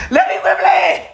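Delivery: high laugh through the words. Real, not acted. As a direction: laugh while saying it. Laughing at herself.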